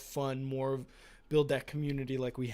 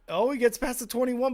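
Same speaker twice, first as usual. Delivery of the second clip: higher, louder live call; excited; 9 semitones higher, 6 dB louder than usual. Real, not acted. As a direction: call the return as it breaks open. Live kick return, rising excitement.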